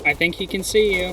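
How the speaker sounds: sudden loud alarm; loud warning. Real, not acted. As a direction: blurt it out loud. Warning teammate under fire.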